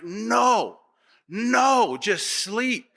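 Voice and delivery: mock desperate pleading with a child, rising on the second no. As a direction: beg the baby to just sleep, half-joking. Mother pleading with crawling child.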